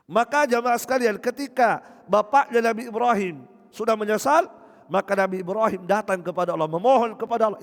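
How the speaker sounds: loud, high sermon delivery; full-force preaching; fervent preaching. Real, not acted. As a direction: drive the point home to the congregation.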